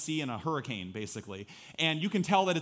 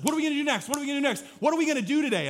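put-on much higher, louder whiny kid voice; impatient little boy; 11 semitones higher, 6 dB louder, much faster than usual. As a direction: whine it like a bored six-year-old. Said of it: "he's like".

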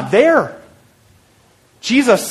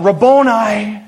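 mild, held-out cry of recognition; retold yell; 3 semitones higher, 3 dB louder, slower than usual. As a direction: call out to a beloved teacher, restrained. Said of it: "she yells out".

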